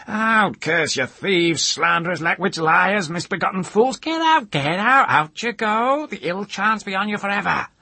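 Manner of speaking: put-on shrieking high, loud old-woman curses; furious high-pitched screech from the doorstep; shouting. A narrator's reading. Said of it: "glaring with reddened eyes and shouting".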